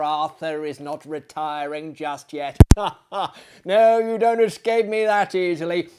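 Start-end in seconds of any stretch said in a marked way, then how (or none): 2.6-3.3 a bright burst of real laughter. Real, not acted. Host laughing at his own joke to viewers, real laugh. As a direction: laugh before teasing the viewers.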